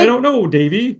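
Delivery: mock dopey cartoon dog voice; hammed-up doubtful claymation dog voice; Goliath the dog. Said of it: "So perfect".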